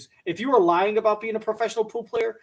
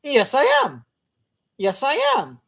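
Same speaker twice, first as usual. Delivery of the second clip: mock high-pitched yes, said higher; 6 semitones higher, 3 dB louder than usual. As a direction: imitate her nervous yes, higher. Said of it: "she goes high pitched".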